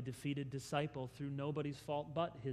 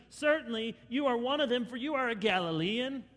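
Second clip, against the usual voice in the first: loud, raised accusation; bystanders accusing; 7 semitones higher, 6 dB louder than usual. Acted. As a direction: pin him down firmly. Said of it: "the bystanders come to him".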